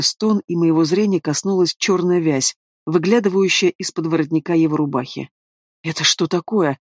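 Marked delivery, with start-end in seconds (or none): 5.8-6.8 tight, understated demand; through gritted teeth. A narrator's reading. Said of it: "процедил я сквозь зубы".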